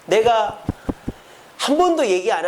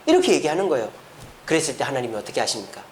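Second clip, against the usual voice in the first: ordinary delivery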